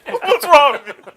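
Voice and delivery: high, loud mock horror; mock outrage. Ham up the horror.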